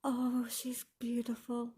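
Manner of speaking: breathless, hushed whisper of wonder; awed whisper. Real, not acted. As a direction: whisper it, overcome by how pretty she is. Moved by the new item.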